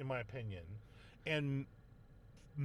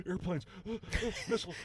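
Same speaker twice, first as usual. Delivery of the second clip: mock gasping; 4 semitones higher, faster than usual. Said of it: "You're hyperventilating".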